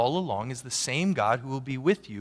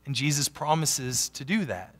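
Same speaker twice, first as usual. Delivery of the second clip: usual speaking voice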